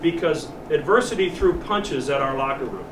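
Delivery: normal talking voice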